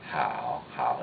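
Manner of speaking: "How" dropped to a deep, low tone; deep third tone. Shown as a demonstration. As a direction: keep each "how" low in the voice. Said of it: "It's a deep tone".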